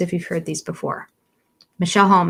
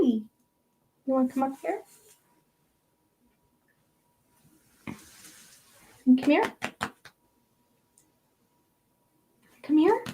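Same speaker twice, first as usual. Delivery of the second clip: high, softer pet-calling voice; coaxing a pet; 7 semitones higher, 5 dB quieter than usual. Real, not acted. Vlogger calling her cat up, high coaxing voice. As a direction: coax the cat over in a high voice.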